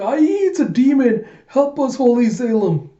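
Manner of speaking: high, loud terrified scream; panicked soldiers. Acted. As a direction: shriek for help from a demon. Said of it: "all the soldiers going".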